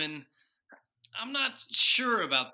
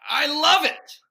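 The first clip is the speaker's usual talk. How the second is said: loud, high-pitched burst of delight; delighted outburst; 10 semitones higher, 10 dB louder than usual. Acted. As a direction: blurt it out, thrilled and loud. Washington delighted by the proposal.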